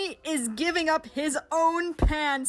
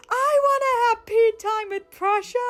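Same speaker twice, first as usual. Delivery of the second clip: much higher, squealing fangirl voice; gushing fangirl; 10 semitones higher, 6 dB louder than usual. Retold or imitated. Fan comment read as a smitten, squealing fangirl. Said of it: "one of the top comments was".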